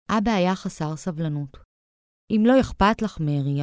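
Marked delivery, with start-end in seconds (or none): none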